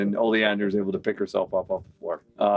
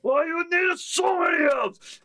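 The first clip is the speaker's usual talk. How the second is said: much higher, slow yelled orc complaint; exasperated orc shout. Acted. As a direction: throw up your hands like a fed-up brute.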